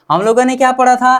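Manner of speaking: usual speaking voice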